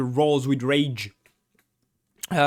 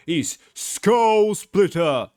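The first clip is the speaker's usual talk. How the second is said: slow, loud boxing announcer drawl; the ruffian announces the ogre, loud and slow; ring announcer; 5 semitones higher, 5 dB louder, much slower than usual. Acted. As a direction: stretch the name out like a ring announcer. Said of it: "the ruffian announces".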